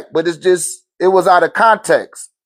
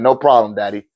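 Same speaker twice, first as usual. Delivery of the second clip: put-on cool kid reply; 9 semitones lower than usual. Voicing his ten-year-old son.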